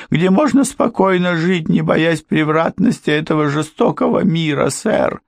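high, fawning housekeeper's plea; humble pleading. A narrator's reading. Housekeeper pleading with Ford for mercy, high humble. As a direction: throw yourself on their mercy.

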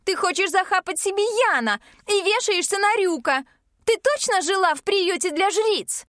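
high, furious sister voice; shouting. Acted. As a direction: hurl the insult at her. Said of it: "Её голос срывается на крик".

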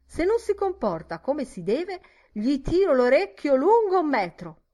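high, loud telling-off; scolding threat. Acted. Barmaid threatening to pull an ear, high loud scolding.

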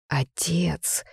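whispered, faltering shock; voice trembling. A narrator's reading. Man realises his father's part, whispering unsteadily. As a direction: breathe it out as a shaken whisper. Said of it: "Его голос дрогнул".

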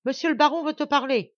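loud call from the balustrade; shouting. Acted. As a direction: yell the order from the balcony. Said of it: "cria Lacheneur".

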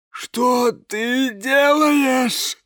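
ordinary delivery

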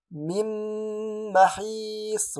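من محيص with nun merged into meem, long held nasal, very slow; nasalized merge. Shown as a demonstration. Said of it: "لا أقول".

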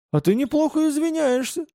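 high-pitched angry jibe; spiteful sarcasm, pitch lifted high. A narrator's reading. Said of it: "зло сказал Дима".

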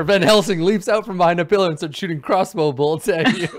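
high, loud narration through laughter; cracking up while hamming up an action scene; excited laughing narration. Pretend. Game master spinning a silly vampire chase, laughing and loud.

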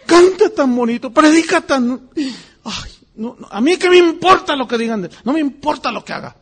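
high, loud, fast adoring gush; gushing devoted fan. Pretend. Imitated churchgoer raving about a beloved preacher, high excited gush. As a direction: rave about him as if nothing else matters.

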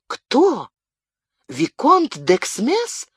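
high exclaimed question, drawn out; exclaiming. A narrator's reading. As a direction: cry it out in disbelief. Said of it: "вскричала Бабетта".

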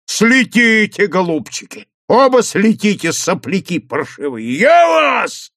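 громко и выше, на крике; разбушеваться в одиночку; ярость. A narrator's reading. Совхоз один бушует в ярости. (совхоз бушует после ухода сыщиков)

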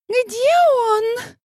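high sharp squeal; shrill squeal. A narrator's reading. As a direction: squeal it in a jealous fit. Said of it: "взвизгнула Марта".

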